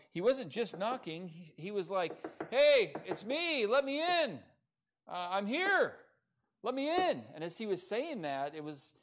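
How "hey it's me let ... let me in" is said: high, loud call from outside; mock shout to be let in. Peter calling from outside gate.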